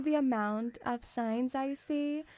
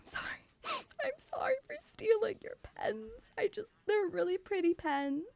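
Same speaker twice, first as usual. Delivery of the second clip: gasping, higher hammed-up apology; mock sorry child; 8 semitones higher than usual. Young self apologising for stealing pens, hammed-up teary. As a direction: fake a tearful little apology. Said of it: "I was like".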